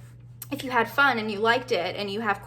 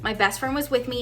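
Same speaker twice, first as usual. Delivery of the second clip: ordinary talk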